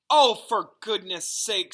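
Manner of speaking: loud, slow, fed-up groan of a line; exasperated. A narrator's reading. Hermione scoffing at the seer's prophecy, loud and exasperated. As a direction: drag it out in disbelief.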